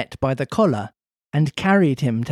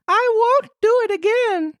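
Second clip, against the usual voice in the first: put-on high boyish voice, tearful; a puppet boy pleading in tears; Pinocchio crying. Pinocchio caught, crying to the farmer. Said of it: "cried Pinocchio in tears".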